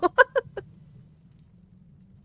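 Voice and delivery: a shrill, breathless burst of laughter, up high; high squeaky laugh. Real, not acted. Player laughing at the crooked circle, squeaky high laugh. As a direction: crack up at the wonky circle.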